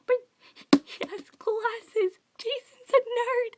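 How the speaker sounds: high, slow, near-tearful squeal of delight; fangirl squeal. Real, not acted. Fan gushing to viewers that Jason has glasses. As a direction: stretch "glasses" out like you might burst.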